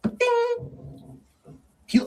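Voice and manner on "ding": high sung ding; elevator ding. Retold or imitated. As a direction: chime like an elevator. Imitating elevator.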